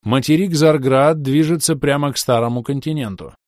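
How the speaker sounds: loud, urgent public address; alarm announcement. Acted. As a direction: announce the danger over loudspeakers. Ship-wide alarm announcement.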